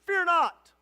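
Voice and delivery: loud, high rebuke; angel's rebuke. Acted. Angel shaking Hagar out of despair, loud rebuke. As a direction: shake her awake with it.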